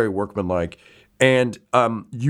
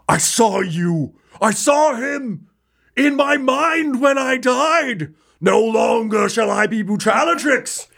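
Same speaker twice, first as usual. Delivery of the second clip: reborn warrior; 9 semitones higher than usual. Acted. Revived Brutalatrix renouncing the old name, swelling proclamation. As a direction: swell from solemn vow to high shout. Revived fighter rises, eyes glowing.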